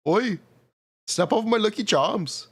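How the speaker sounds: high mock Irish leprechaun accent. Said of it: "Is that Indian or Irish? It's supposed to be Irish".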